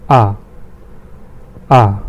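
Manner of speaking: A said as open "ah"; A as German "ah", each one set apart; German letter A. Shown as a demonstration. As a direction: sound out A as a long "ah". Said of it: "See the sound I'm making".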